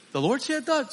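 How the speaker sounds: high, startled question; mock surprise. Preacher voicing the startled man, high and surprised. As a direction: lightly play the man's disbelief.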